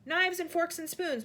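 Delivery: a fretting butler grumbles slowly; muttering butler. A narrator's reading. Butler mutters to himself about cutlery. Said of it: "was heard to mutter".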